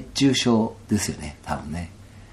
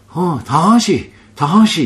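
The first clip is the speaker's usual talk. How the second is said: grandfather calling; 9 semitones higher, 8 dB louder, much slower than usual. Retold or imitated. Old man beckoning him over.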